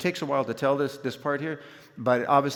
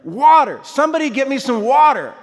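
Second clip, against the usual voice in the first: high, loud shout for help; desperate yell; 12 semitones higher, 12 dB louder than usual. Retold or imitated. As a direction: yell out as if in agony, calling for urgent help. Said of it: "and I yell".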